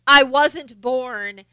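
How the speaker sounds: slow drawled Southern vowels; mock Southern drawl. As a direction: stretch it like a put-on drawl. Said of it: "she doesn't say".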